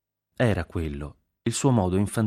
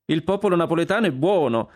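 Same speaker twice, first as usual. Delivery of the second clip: high, louder repeat of the claim; emphatic echo; 11 semitones higher, 4 dB louder than usual. Acted. Consuelo echoing the prince's claim, high and emphatic. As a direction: throw the words back, pitched high.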